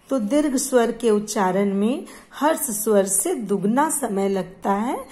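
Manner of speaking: ordinary talk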